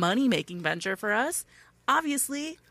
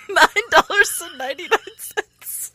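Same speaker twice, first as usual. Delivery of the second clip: laughing; 7 semitones higher, 4 dB louder than usual. Real, not acted. Laughing correction.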